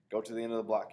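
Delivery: bossy commander; ordering. A narrator's reading. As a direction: give the order. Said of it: "he orders".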